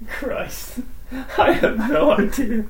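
whispered laughing despair. Real, not acted. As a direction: laugh through the words. Stumped by a trivia question.

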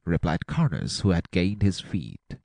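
plain reading voice

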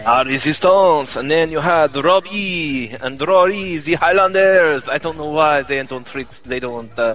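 mock Scottish accent, lightly done. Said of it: "that's exactly what Scottish people sound like".

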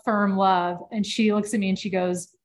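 normal talking voice

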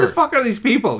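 high, loud disbelieving outburst; exasperated shout. Real, not acted. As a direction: yell it in disbelief. Exasperated at name list.

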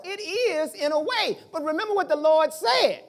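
full-force pulpit preaching. Acted. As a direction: preach it out to the church. Preaching to congregation.

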